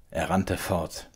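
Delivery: plain reading voice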